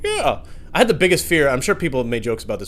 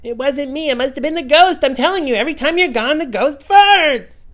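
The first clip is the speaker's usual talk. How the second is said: defensive wife; 9 dB louder than usual. Pretend. Wife denying the fart to her husband, high and loud. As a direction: insist it was the ghost, voice up high.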